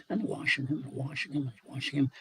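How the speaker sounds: plain reading voice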